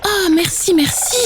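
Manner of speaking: high, bright thank-you from a little chick; grateful little chick exclaiming. Acted. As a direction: cry out thanks, pitched high. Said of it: "s'exclame Séraphin".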